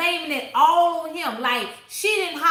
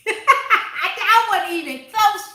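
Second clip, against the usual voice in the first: higher, louder, through real laughter; cracking up while admitting it; laughing; 6 semitones higher, 5 dB louder than usual. Real, not acted. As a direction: laugh at your own mistake. Laughing at own botched name.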